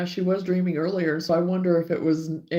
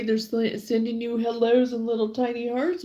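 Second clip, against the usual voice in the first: normal talking voice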